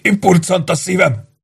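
high, strained whimper; pained groaning plea. A narrator's reading. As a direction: moan it like a tortured man. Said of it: "nyöszörögve felé fordult".

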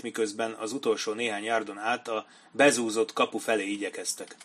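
usual speaking voice

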